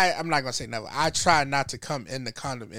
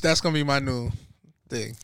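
ordinary delivery

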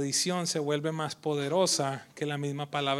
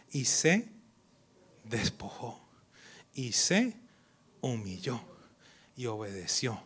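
soft unvoiced whisper; 5 semitones lower, 3 dB quieter, slower than usual. Real, not acted. Pastor whispering Jesus' humbling to church. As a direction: hush the word. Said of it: "preaching Jesus humbled".

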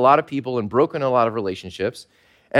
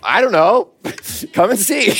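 amused reply; 6 semitones higher, 3 dB louder than usual. Retold or imitated. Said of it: "And what can you say?".

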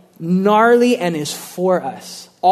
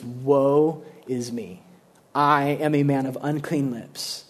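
lower, soft and slow contrition; broken lament; 4 semitones lower, 5 dB quieter than usual. Retold or imitated. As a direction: confess in hushed sorrow. Isaiah undone before God.

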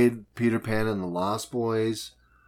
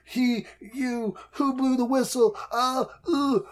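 much higher, whiny fake-tearful pleading; mock crying Jane; 10 semitones higher than usual. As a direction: whimper like a caught girl near tears. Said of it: "she's like".

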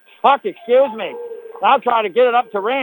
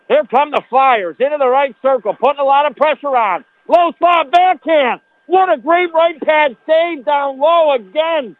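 higher, louder rush of play call; excited play-by-play; 5 semitones higher, 3 dB louder than usual. Real, not acted. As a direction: build up to the save. Pressure and big save.

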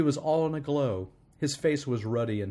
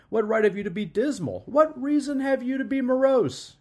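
very high, lilting playful questions; gaily; 12 semitones higher, 6 dB louder than usual. A narrator's reading. Nephew turning Scrooge's questions back on him, gaily. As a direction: tease back gaily, pitch way up. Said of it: "returned the nephew gaily".

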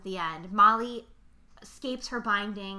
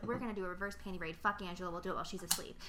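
conspiratorial plotting; 3 semitones lower, 9 dB quieter, faster than usual. Retold or imitated. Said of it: "the girl's like".

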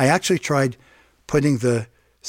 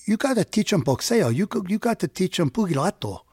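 put-on higher, friendly Chicano accent; Chicano-accented street talk, higher and lively; 6 semitones higher than usual. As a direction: imitate a kind Chicano neighbor's accent. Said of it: "with a sweet Chicano accent".

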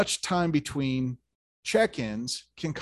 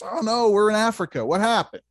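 higher and louder, mock alarm at the wrong continent; shocked outburst; 8 semitones higher, 8 dB louder than usual. Pirate captain to his crew on finding Africa, mock alarmed. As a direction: act the captain's hammed-up shock. Captain finds ship off course.

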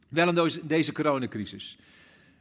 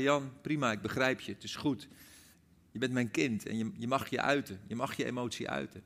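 gentle God's voice; 4 semitones lower, 6 dB quieter than usual. Acted. God's gentle answer retold.

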